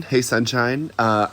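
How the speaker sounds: normal talking voice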